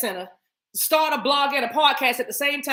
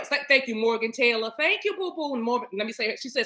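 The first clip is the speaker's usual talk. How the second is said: normal talking voice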